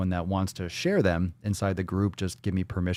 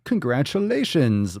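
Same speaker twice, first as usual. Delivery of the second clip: 1 drawn-out congratulations; 5 semitones higher, slower than usual. Acted.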